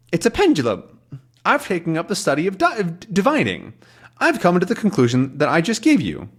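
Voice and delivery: put-on old professor. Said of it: "he says".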